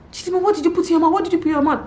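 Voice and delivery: put-on demanding answers from the toddler in alarm; panicked questioning. Mother rushing to her toddler, panicked and fast.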